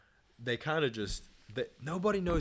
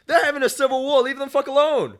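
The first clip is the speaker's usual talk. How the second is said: much higher and louder, indignant; heated outburst; 13 semitones higher, 15 dB louder than usual. Real, not acted. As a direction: let the indignation burst out. Heated rant about foreign wars.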